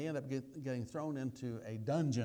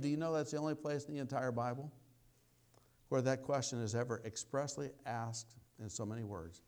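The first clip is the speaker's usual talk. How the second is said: ordinary talk, nothing marked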